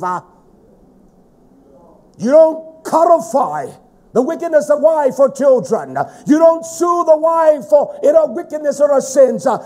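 loud, driving sermon delivery; forceful preaching. Real, not acted. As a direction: preach it hard, stretching the key words.

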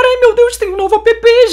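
high-pitched excited squeal; real excitement. Real, not acted. Gamer tells viewers about the new app, excited. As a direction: burst out thrilled, voice way up. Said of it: "porque eu tô muito animada".

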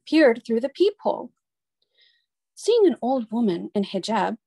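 ordinary delivery, nothing marked

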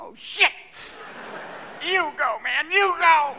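high-pitched excited dog voice; sniffer dog. Acted. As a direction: blurt it out high as a startled dog. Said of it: "all the dogs looking for anthrax are going".

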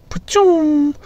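high mouth-made gun blast "pa-choom"; mock gunshot. Firing at a bull, high mock gunshot. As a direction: voice the shot like a kid's toy gun.

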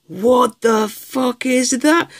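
high, slow stunned question; can't believe what he pulled; shocked disbelief. Real, not acted. Seeing a giant dinosaur card, stunned.

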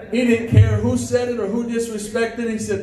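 ordinary talk

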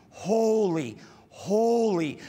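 holy, holy rising slightly on each repeat; growing stress on repeated holy; emphatic repetition. Shown as a demonstration. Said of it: "The repetition is".

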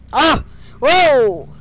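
sudden loud high yelp of surprise; startled. Real, not acted. Gamer spotting an unseen guard, loud startled yelp. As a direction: jump at the sight, real fright.

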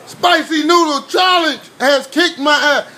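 high shouted complaint; shouting. Real, not acted. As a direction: shout it out, defeated by the heat. Exclaiming after spicy noodles.

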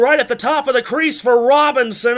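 normal talking voice

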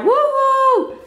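high, drawn-out cheer; celebratory whoop. Real, not acted. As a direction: whoop for a milestone just reached. Celebrating her hundredth ride.

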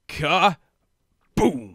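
drawn-out dramatic Ka-boom; playful announcer voice. Retold or imitated. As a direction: announce it like a game. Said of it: "And then he's like".

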